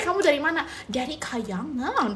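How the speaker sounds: normal talking voice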